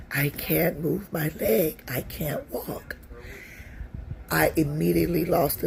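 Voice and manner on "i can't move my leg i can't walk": slow, fading almost to a whisper; weak, helpless. Retold or imitated. Wife tells husband as he lifts her, weak and slow. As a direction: labor through it, voice dropping away. Retelling losing use of her legs.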